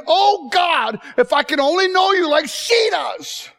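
preacher reliving his tearful prayer; yearning prayer. Retold or imitated. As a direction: plead it like a broken man praying. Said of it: "I'm thinking".

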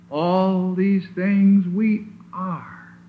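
very slow build that lands on are; slow preacher's stress on are. Shown as a demonstration. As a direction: drag it out and land hard on are. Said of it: "emphasize the word are".

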